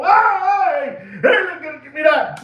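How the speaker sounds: high-pitched mock shout; mock robber yell. Robber grabbing the watch from the victim, high mock shout. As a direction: yank it away, barking high. Acted robbery grab, retold.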